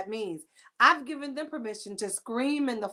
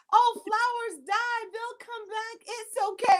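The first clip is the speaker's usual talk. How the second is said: neighbor consoling; 10 semitones higher than usual. Retold or imitated. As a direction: coo it like a kindly neighbor. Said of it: "my neighbor said".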